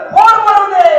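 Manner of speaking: woman shouting back at the driver; angry retort. Retold or imitated. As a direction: fling back a careless yell. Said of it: "मी पण म्हणलं".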